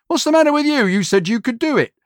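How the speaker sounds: a retold, high-pitched telling-off; exasperated boss. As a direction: snap at the useless assistant. Boss scolding his assistant.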